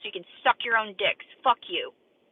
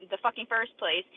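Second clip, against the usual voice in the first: ordinary delivery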